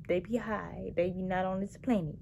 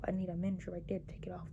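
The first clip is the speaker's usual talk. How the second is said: fast, breathy confiding whisper; whispered aside; 7 dB quieter, much faster than usual. Real, not acted. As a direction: quickly let the viewers in on a secret.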